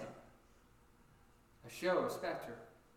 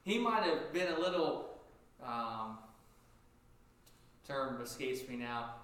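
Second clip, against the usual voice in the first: ordinary delivery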